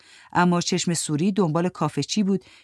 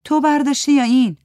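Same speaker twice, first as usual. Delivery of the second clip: higher, louder accusing voice; sharp character question; 9 semitones higher, 7 dB louder than usual. Acted. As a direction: demand an answer, pointedly. Character's angry question in café. (پرسش تند و طلبکارانه با صدای بلندتر)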